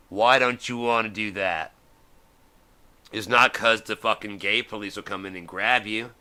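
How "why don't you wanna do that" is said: pressed again, louder, with stress on why; louder repeat. Shown as a demonstration. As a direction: push the question harder.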